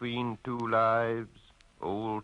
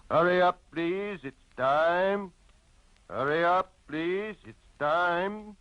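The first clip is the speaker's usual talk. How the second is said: barman's call; 7 semitones higher than usual. Acted. Barman calling closing time.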